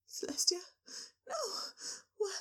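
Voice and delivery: high, mock-crying plea; hammed-up distressed pony voice; distraught cry. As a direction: wail it like a heartbroken pony. Twilight distraught for Celestia.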